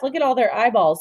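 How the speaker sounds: plain reading voice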